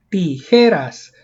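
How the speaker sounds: tijeras slowly, leaning on JE; stress on second-last syllable. Shown as a demonstration. Teacher repeating the word to reveal its stress, stressed JE. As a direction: draw out JE in tijeras, falling away. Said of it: "dónde lleva la mayor fuerza de voz".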